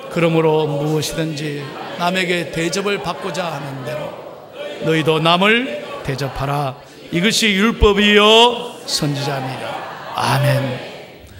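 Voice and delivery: slow, solemn communal reading; scripture recitation. Acted. Pastor leading the congregation in reading Matthew, slow recitation. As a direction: read the verse slowly and grandly.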